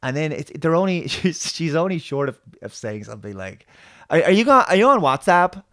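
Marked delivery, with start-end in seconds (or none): 4.1-5.6 put-on much higher, much louder gushing valley girl; millennial girl voice. Mocking the show's alien as a trendy girl, much higher and louder. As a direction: gush like a social-media teen. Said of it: "they're only short of speaking like this".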